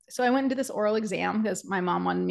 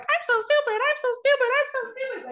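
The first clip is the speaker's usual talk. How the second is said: squeaky, much higher cartoon mouse; Mickey Mouse voice. Shown as a demonstration. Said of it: "Mickey Mouse voice".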